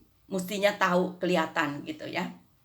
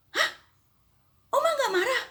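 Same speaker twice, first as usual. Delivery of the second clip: high, astonished voice; high startled question; surprised neighbour; faster than usual. Retold or imitated. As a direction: react in disbelief.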